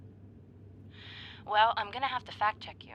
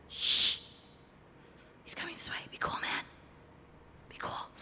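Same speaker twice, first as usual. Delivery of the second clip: urgent whisper; 8 semitones higher than usual. Acted. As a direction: hiss at him to stay calm. Whispering as actor approaches.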